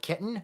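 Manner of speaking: "kitten" with the T caught in the glottis; glottal T. Shown as a demonstration. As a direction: Midwestern "kitten", no tongue-released T. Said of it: "it's occurring in the glottis here".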